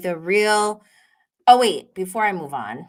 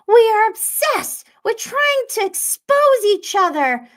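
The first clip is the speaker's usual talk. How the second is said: mocking high imitation of Heather; high put-on voice; 14 semitones higher, 6 dB louder than usual. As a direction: squeal it in a high caricature.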